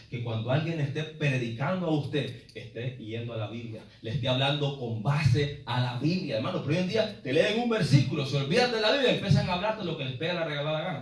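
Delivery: ordinary delivery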